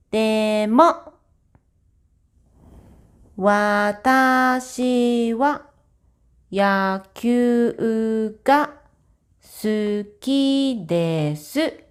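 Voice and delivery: slow, clear Japanese example sentences; careful model reading. Shown as a demonstration. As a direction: read it like a language tape for beginners.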